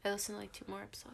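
fast soft whispered aside; whispering. Real, not acted. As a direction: whisper it quickly.

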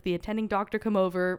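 normal talking voice

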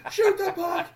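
loud, high-pitched mimicked holler; imitated TV yelling. Driscoll yelling at the Bruins game, high imitated holler. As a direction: yell it high like a worked-up fan. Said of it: "he's, like, yelling at the T V".

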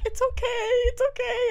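high, restrained mock soothing; reactions held back. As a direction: comfort a child while hiding horror. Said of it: "holding all my reactions back".

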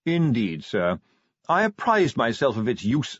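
deferential butler, formal. Acted. Butler explaining the burning shrubbery to Vimes, deferential. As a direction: report formally, stretching the aside "I suspect".

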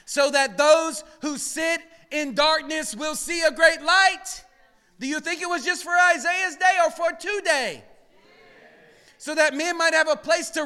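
a preacher rising to his climax, loud and high; preaching at full force. Real, not acted. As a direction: build and shout it to the rafters.

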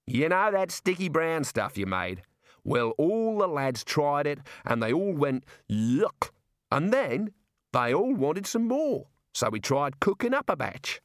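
Australian character voice, key words drawn out; cheerful Australian jailer, recounting. Acted. Jailer recounts cooking experiments to Rincewind, cheerful Australian voice. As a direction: tell the tale with relish, stretching words.

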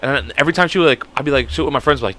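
ordinary talk, nothing marked

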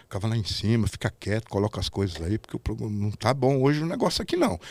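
imitated low, quiet warning; lightly voiced caution, kept low and soft; Valentino's warning. Valentino warns the new guy the show is going badly, low and quiet. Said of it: "O Valentino falou".

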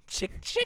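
whispered chick chick reload; reloading sound. Pretend. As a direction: click the gun like reloading.

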